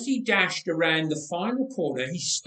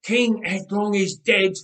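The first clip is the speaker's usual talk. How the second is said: a loud, higher battle call; shouted; 4 semitones higher, 6 dB louder than usual. Acted. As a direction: call it out to the army. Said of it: "as soon as he shouted".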